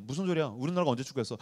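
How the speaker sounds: low, soft deadpan rebuttal; acted dry reply to an excited fan; unmoved pastor. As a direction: bring it right down, unimpressed.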